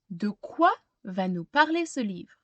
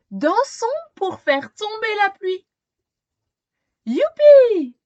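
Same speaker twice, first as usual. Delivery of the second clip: higher and louder, a joyful shout of youpi; excited cheer; 8 semitones higher, 10 dB louder than usual. Acted. Luciole calling everyone to dance for rain, excited cheer. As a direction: burst out with childish glee.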